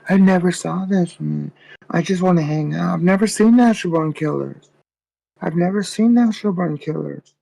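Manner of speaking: put-on high, innocent girly voice; mock girlfriend. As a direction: play her high and fake-innocent. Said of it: "She goes".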